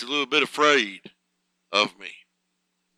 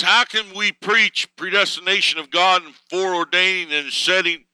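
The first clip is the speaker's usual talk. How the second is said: quoted sermon read at full pulpit force, higher and louder; ringing revival-style reading; quoting a preacher; 8 semitones higher, 3 dB louder than usual. Retold or imitated. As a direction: deliver the quote like a fervent preacher. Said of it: "he says".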